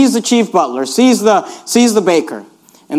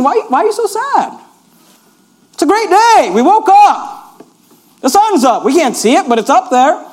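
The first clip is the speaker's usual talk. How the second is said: higher, upbeat encouragement; cheerful Joseph; 8 semitones higher than usual. Retold or imitated. Joseph cheering up the butler and baker, higher and upbeat. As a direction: lift the prisoners' spirits. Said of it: "looking at these guys saying".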